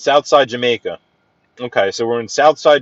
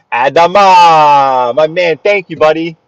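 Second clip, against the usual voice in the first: drawn-out shout-out; 4 semitones higher, 8 dB louder, slower than usual. Real, not acted. Shout-out to viewer in chat.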